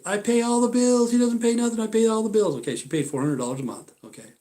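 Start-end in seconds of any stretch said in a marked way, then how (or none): 0.1-2.6 high, mocking singsong; mocking her again. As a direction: imitate her complaint with scorn. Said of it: "she'll say".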